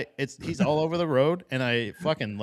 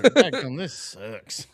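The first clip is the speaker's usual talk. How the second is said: mock outburst fading; 3 semitones higher, 7 dB louder, much slower than usual.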